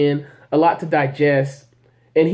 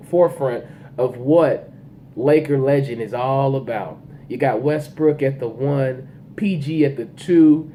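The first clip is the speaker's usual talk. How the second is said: usual speaking voice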